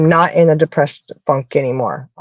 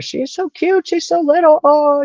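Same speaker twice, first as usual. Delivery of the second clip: much higher, slow cooing over a tiny dog; cooing baby talk; 11 semitones higher than usual. Pretend. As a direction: gush like talking to a puppy. Gushing about the little dog.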